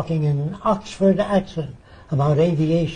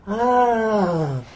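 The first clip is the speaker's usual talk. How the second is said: long, higher "aah" of dawning understanding; imitated amazed "ah"; 6 semitones higher than usual. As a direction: draw out a mock sigh of revelation.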